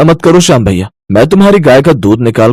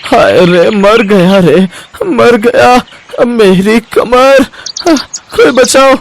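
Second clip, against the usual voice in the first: high, slow, drawn-out cries of pain; pained wailing; 6 semitones higher, much slower than usual. Acted. Shyam on the ground after slipping, wailing in pain. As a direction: groan it out as if your back just gave way. Shyam falls and hurts his back.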